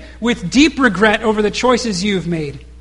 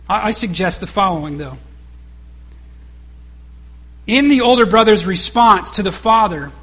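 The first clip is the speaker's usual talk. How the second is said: ordinary delivery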